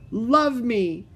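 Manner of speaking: loud, high, hurt cry; fierce with hurt. Acted. Ramona cries out to her mother, fierce with hurt. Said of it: "Her voice was fierce with hurt".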